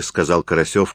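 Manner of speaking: ordinary delivery, nothing marked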